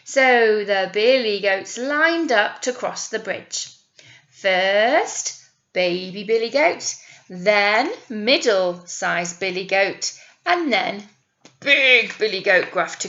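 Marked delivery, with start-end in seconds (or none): none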